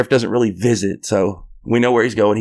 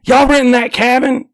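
high, mock Southern neighbor voice; imitating the neighbor; 12 semitones higher than usual. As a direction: repeat it in a high country-neighbor voice. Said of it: "he goes".

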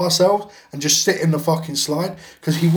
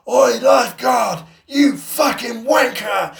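high, drawn-out mock holler; slow, stretched, high-pitched jeer; retold taunting shout; 8 semitones higher, 3 dB louder, slower than usual. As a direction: imitate a cheeky lad yelling across a pool. Cousin taunts lifeguard from diving board.

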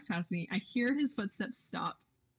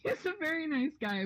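laughing; 9 semitones higher than usual. Real, not acted. Laughing while telling.

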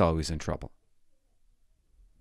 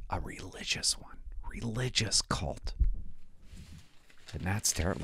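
conspiratorial whisper; 4 semitones lower, 10 dB quieter than usual. Pretend. Comic telling audience the paper comes from a cult, whispered. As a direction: hush it like a dark secret. Mock-secret aside to audience.

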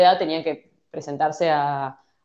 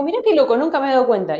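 higher, amazed realization; surprised friend; 6 semitones higher, 3 dB louder, faster than usual. Retold or imitated. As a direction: realize something for the first time. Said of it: "me dijo". (asombro agudo)

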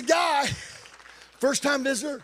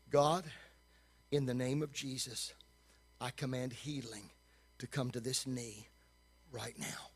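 hushed, low command of healing; quiet prayer; 9 semitones lower, 13 dB quieter than usual. Shown as a demonstration. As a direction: softly command the healing as if beside him. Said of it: "it was like this".

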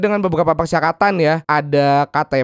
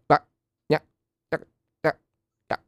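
choppy broken phone line; breaking up call. Acted. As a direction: cut words like a bad signal. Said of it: "suaranya putus-putus".

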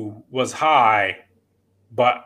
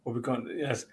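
panicked whisper; 2 semitones lower, 11 dB quieter than usual. Retold or imitated. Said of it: "My brother starts going".

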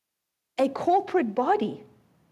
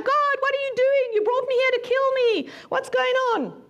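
higher, whiny plea to God; 1 Joshua's complaint; 9 semitones higher than usual. Retold or imitated. Preacher voices Joshua protesting to God, in a higher, whiny plea. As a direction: play a baffled, hurt Joshua. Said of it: "he's like".

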